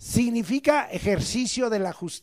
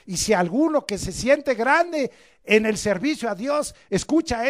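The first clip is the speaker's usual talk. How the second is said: loud, rising preacher's cry; fiery preaching; 3 semitones higher, 11 dB louder than usual. Acted. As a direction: preach it hard to the congregation. Preaching at full force.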